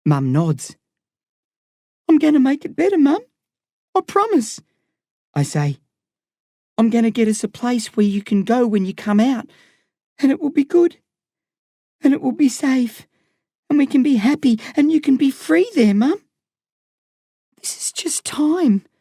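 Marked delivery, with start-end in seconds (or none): 2.1-16.2 put-on higher boy voice, earnest promise; a son vowing a better life; young boy promising. A narrator's reading. 17.6-18.9 whispered. A narrator's reading. Boy whispers reassurance to his mum.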